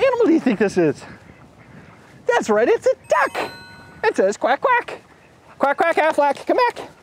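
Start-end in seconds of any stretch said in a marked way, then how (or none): 4.4-5.0 high playful quack; duck quack. Pretend. Kids' show host quacking like a duck. Imitating duck sound to kids. 5.6-6.9 high sing-song quack call; playful quack. Pretend. Host doing the Aflac duck for kids.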